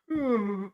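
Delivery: high, soft mock yawn hum; imitated yawn. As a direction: mimic a sleepy yawning hum. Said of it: "He's like".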